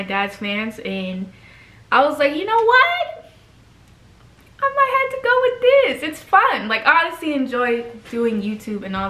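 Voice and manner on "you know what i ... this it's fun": pitched way up, bright and eager; high excited inner voice. Retold or imitated. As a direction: squeal it out like a giddy decision. Quoting own decision, high far.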